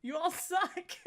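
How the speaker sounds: high, giggling taunt; screaming at YouTubers, laughing through it; laughing mock yell. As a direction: yell it while cracking up. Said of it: "screaming up at all these fucking YouTubers".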